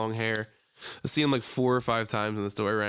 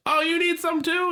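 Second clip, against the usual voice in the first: put-on much higher, louder old man cooing; old man impression; 5 dB louder than usual. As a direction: ask the second dog in a high coo. Old man offering the other dog.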